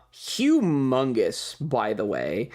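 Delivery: ordinary talk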